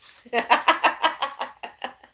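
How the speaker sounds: big burst of high laughter; sudden high laugh; loud laugh. Real, not acted. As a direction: laugh out loud at your own joke. Laughs at own kidney stone joke.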